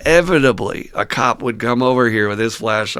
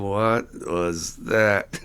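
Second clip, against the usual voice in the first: stunned bystander; 3 semitones lower, much slower than usual. Retold or imitated. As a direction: drag it out like you can't believe it. Bewildered bystanders watching cars pass.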